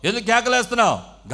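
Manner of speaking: acted crowd scolding, high and very loud; loud rebuke. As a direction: shout him down in short bursts. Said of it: "గద్దిస్తున్నారంట అతన్ని".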